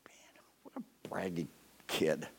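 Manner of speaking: unvoiced slow whisper; whispered aside. Listener judging David aside, soft whisper. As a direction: mutter it under your breath, mocking. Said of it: "You go".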